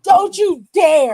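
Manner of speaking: loud shouted warning; shouting. Real, not acted. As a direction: yell it to stop them. Warning friend off zombie noise.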